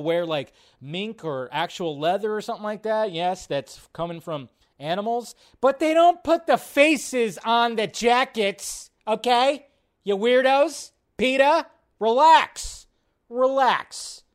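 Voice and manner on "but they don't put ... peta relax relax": exasperated. Real, not acted. Scolding peta over face jackets.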